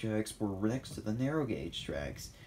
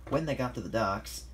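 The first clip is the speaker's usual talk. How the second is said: usual speaking voice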